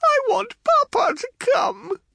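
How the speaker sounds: put-on very high, plaintive child's voice; frightened sobbing. Frightened child wanting a parent, sobbing. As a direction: wail it thinly as a scared child. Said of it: "sobbed Yonsei".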